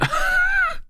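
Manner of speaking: high squealing burst of laughter; shrieking laugh. Real, not acted. Loud burst of laughter on stream.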